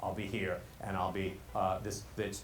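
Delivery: low, soft monotone; park it on the bottom note; low single pitch. Acted. Speaker claiming two melodies shows the first, low monotone. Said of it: "I at least have two melodies".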